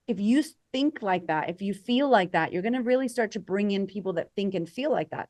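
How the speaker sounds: normal talking voice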